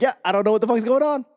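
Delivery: quick, high-pitched mock bafflement; baffled outburst. Student lost on a Duolingo level, baffled. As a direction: rattle it off, totally lost. Acting out confusion at the app.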